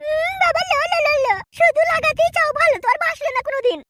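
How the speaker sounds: high, teasing, playful complaint; coy wife voice. Acted. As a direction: coo it like a flirting housewife. Wife coos and complains to lover.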